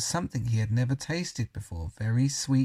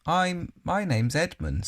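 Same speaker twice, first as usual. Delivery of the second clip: awkward Edmund; 7 semitones higher, slower than usual. A narrator's reading. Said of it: "rather awkwardly".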